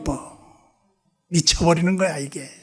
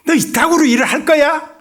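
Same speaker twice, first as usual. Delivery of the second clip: sharp, raised scolding rebuke; 9 semitones higher, 5 dB louder than usual. Retold or imitated. Pastor scolding a lazy Gyeongsang member, raised rebuke. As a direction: tell him off loudly and high.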